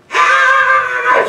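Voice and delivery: very high, very loud shriek of alarm; mock scream. Guard finds underwear in the bag, shrill mock scream. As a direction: shriek like a startled security guard.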